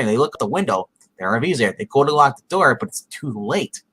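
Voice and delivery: ordinary talk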